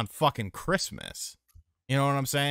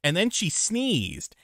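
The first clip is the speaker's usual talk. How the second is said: outraged loud storytelling; 12 semitones higher, 8 dB louder than usual. Real, not acted. Story climax, rising outrage.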